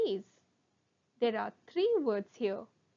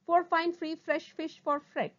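brisk, higher-pitched run; sped-up tongue twister; 4 semitones higher, 4 dB louder than usual. Shown as a demonstration. Teacher shows the twister at speed, briskly. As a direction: push through without pausing. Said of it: "speed up".

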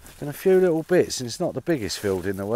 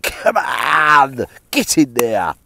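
loud excited cheer; cheering the find; 2 semitones higher, 10 dB louder, much slower than usual. Real, not acted. Detectorist cheering a signal in the field, loud and excited. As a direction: get excited and shout it.

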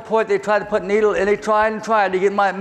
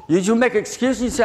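usual speaking voice

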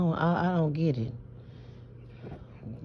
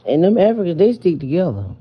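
emphatic; 7 dB louder, faster than usual. Real, not acted. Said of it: "emphatic warning".

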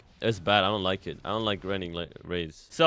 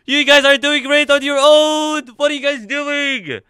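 high, loud indignant shout; outraged yelling; 13 semitones higher, 9 dB louder than usual. Real, not acted.

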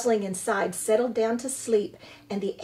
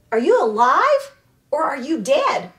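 louder, higher call down from the tree; 5 semitones higher, 8 dB louder than usual. A narrator's reading. The robot calling down from a tree to a creature, loud. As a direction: call down into the dark. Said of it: "The robot's voice called down".

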